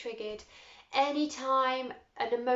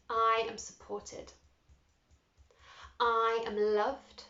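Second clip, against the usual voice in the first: slow, deliberate "I am" statements; measured affirmation voice; slower than usual. Shown as a demonstration. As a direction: say each one for them to echo. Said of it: "repeat after me".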